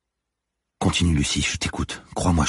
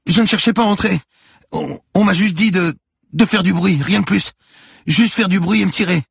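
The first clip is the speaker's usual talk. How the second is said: put-on very high, loud, stammering boy; scared teenager's high-pitched pleading; panicked excuse; 7 dB louder than usual. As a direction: protest frantically with a gun on you. Terrified boy justifies himself at gunpoint.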